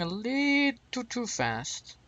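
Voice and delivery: "little" stretched out high and slow; drawn-out understatement. Real, not acted. Wry understatement about landing speed.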